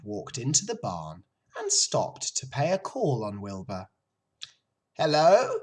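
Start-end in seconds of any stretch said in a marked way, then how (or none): 5.0-5.6 put-on high, loud old sheep greeting. A narrator's reading. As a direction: call out hello as the old sheep.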